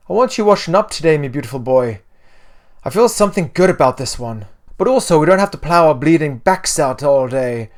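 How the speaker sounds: loud, bustling Irish mother; brisk Irish mum bossing her boy fondly; weird Irish accent. A narrator's reading. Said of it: "a weird Irish accent".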